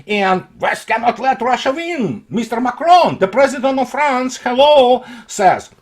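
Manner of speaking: ordinary talk, nothing marked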